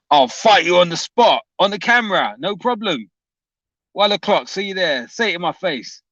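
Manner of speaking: high-pitched put-on Cockney fighting talk; Brian Hurl accent. Streamer mimicking Brian's challenge to a fight, Brian Hurl accent. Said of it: "a Brian Hurl accent".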